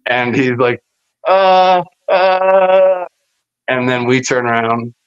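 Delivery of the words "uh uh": mock scared.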